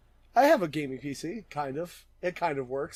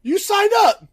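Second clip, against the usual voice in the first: mock shout; 10 dB louder, slower than usual. Real, not acted. Mock shouting match about hurricane.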